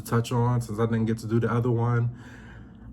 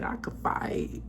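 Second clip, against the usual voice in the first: imitating Kay; 8 dB quieter than usual. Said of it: "And Kay's like".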